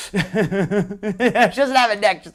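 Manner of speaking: slow, laughing through the words; real laugh running into the sentence. Real, not acted. Amused host reacting to a chat comment on the fighters, laughing.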